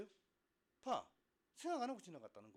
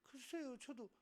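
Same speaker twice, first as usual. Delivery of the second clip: sheepish student; 8 semitones higher, 4 dB quieter, slower than usual. Acted. Student answering scolding teacher.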